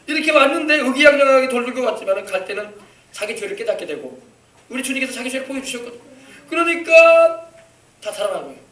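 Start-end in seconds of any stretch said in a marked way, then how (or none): none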